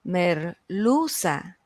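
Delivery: merluza very slow, the middle syllable lu stretched and lifted. Shown as a demonstration. Teacher quizzing students on the stressed syllable, merluza drawn out with stress on lu. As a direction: break up merluza slowly and lean on lu. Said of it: "¿Cuál es la sílaba tónica?".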